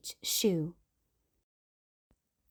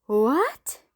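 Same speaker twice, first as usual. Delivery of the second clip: rising, louder disbelief; shocked shout; 5 semitones higher, 4 dB louder than usual. Acted. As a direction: gasp it out together. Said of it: "we all shouted".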